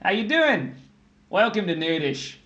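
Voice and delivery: mock Aussie hello; Australian accent. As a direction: greet them like an Australian mate. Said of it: "So I think he's Australian".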